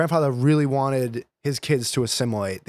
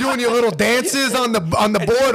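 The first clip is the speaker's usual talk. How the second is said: higher-pitched, loud mocking needle; mocking Italian dad; 6 semitones higher, 4 dB louder than usual. Dad ridiculing his son's skinny jeans and skateboard, mocking. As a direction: tease like a scornful dad. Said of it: "he's like, ... "What, are you gonna have".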